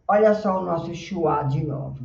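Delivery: ordinary delivery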